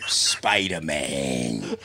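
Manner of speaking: dramatic slow announcer. Pretend. Dramatic slogan, slowed.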